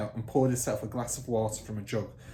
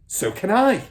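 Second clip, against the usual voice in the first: loud, higher snap; snapped; 10 semitones higher, 11 dB louder than usual. Acted. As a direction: snap it back. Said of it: "Miss Trunchbull snapped".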